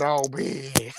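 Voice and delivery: low stunned reaction. Real, not acted. Repeating the reaction to viewers, low stunned voice. Reacting to shocking gossip again.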